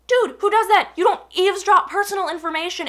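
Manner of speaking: indignant rhetorical question; exasperated. Real, not acted. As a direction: throw up your hands in outrage. Venting about eavesdropper.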